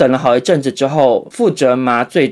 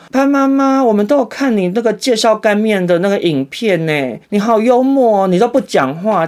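gushing nurse; 7 semitones higher than usual. Retold or imitated. Nurse praising mom's noodle video, a high gushing voice. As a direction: coo at mom like a nurse who is a fan. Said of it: "就跟我妈说".

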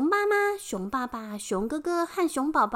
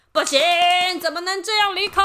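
loud fast monster shout; yelling protest; 5 semitones higher, 6 dB louder, much faster than usual. Acted. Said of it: "怪兽们大叫了起来".

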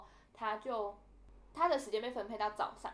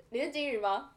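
mock angry scold; 5 semitones higher, 8 dB louder than usual. Angry caller scolding on phone.

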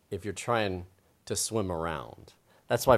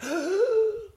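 sharp gasp; 6 dB louder than usual. Acted. Hiccup cure, scaring yourself.

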